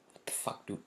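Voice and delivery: a hushed, unvoiced what the fuck; whispered disbelief. Real, not acted. Venting about the driver who blamed him, whispered disbelief. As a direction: mutter it in exasperation.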